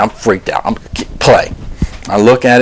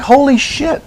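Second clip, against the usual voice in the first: freaked out; slower than usual. Retold or imitated. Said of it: "I'm freaked the hell out".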